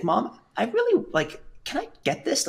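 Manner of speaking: put-on high, begging child voice; pleading kid. A boy begging his mom in the shop, high and pleading. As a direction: beg mom for the game. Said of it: "and I was like".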